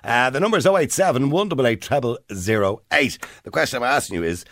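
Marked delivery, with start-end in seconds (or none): none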